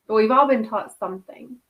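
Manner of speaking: normal talking voice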